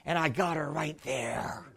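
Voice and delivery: hushed whisper, almost no voice; whispered aside. Real, not acted. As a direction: drop to a secret whisper. Whispered aside in retold story.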